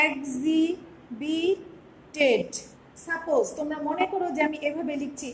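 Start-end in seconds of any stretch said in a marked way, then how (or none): 0.1-1.6 very slow, split into syllables. Shown as a demonstration. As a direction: spell it out slowly, step by step. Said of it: "আমি এভাবে step to step আমি লিখব".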